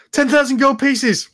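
high loud blurt; blurted demand. Acted. Magically prompted brother blurts the sum, high and loud. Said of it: "your, uh, suggestion goes".

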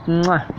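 soft smacking air kiss; kiss sound. Real, not acted. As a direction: blow a kiss to the camera. Blows a kiss.

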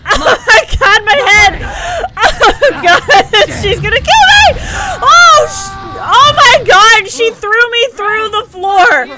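high, loud frantic shrieks; terrified screaming. Real, not acted. Streamer screams as the villain throws her through the floor. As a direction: scream as she's hurled down.

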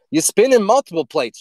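ordinary talk

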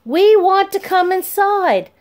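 high, loud, slow plea; hollering. A narrator's reading. Neighbor at the gate hollering to be let in, high and loud. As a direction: yell it through the gate bars. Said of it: "clung to the bars of the gate hollering".

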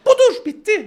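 teacher shouting "Budur" to clinch the point; emphatic shout. Shown as a demonstration. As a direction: belt out "Budur" like a punchline.

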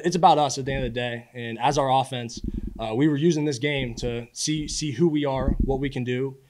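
normal talking voice

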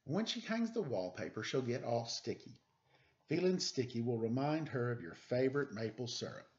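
put-on lively read-aloud for children; storybook reading. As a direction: read it like a bedtime picture book. Reads picture book aloud.

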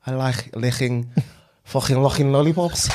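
throaty Scouse k sounds through giggles; Liverpool accent. Shown as a demonstration. Trying the tongue twister for a friend, Scouse accent. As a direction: try a comic Scouse accent, half laughing. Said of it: "Jamie Carragher".

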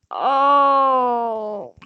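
long loud anguished "Oh"; loud shocked cry. Real, not acted. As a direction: wail it out in shock. Sudden shock in game.

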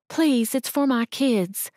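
slow pleading mother. Acted. Mother begging the cashier for her kids, slow and pleading. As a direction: drag out the plea for her kids. Woman's last plea.

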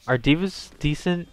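ordinary talk, nothing marked

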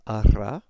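"ara" with a rolled R in the middle. Shown as a demonstration. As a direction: trill the R in "ara". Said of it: "instead of an ara".

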